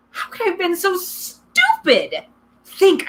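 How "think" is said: high, loud self-command; urging himself. A narrator's reading. Boy urging himself to think, sharp self-command. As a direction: force yourself to focus. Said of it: "I told myself".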